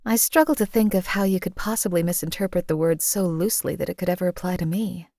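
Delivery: ordinary talk, nothing marked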